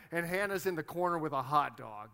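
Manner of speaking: normal talking voice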